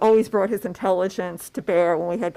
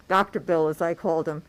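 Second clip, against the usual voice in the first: normal talking voice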